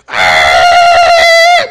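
loud, low roaring yell; war cry. Retold or imitated. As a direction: let out a big charging yell.

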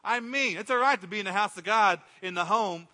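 ordinary talk, nothing marked

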